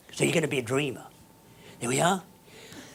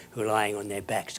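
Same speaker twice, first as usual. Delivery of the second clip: normal talking voice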